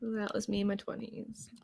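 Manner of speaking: soft unvoiced aside; whisper. Real, not acted. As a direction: whisper the confession.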